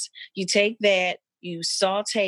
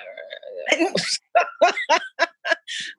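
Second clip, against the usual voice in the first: teary laugh; 10 semitones higher than usual. Real, not acted. Trying not to cry, laughs.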